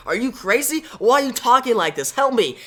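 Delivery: high-pitched, incredulous plea; alarmed disbelief. Acted. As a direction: protest in alarm, pitch jumping up. Wally abandoned, pleading with Barry.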